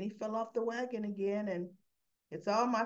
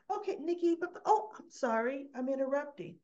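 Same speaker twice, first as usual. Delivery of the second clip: much higher, flustered retold voice; startled interruption; 7 semitones higher than usual. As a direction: act as if walking in on something. Walks in on them, apologizes.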